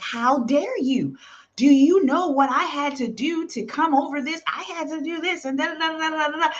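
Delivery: indignant how-dare-you, trailing into da da da; mock indignant rant. Weary pioneer snapping at newcomers, mock indignant. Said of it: "you get so mad".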